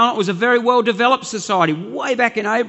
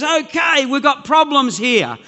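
hammed-up department spokesman; mock official announcement; 6 semitones higher than usual. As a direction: announce it emphatically, pitched up. Acts out department announcing a shake-up.

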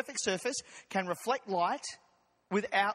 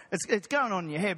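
ordinary delivery

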